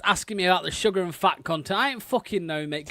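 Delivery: usual speaking voice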